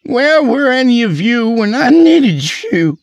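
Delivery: high, loud anguished shout; angry accusation. Acted. Drunk man accuses colleagues, anguished shout. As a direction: shout it as the betrayed friend.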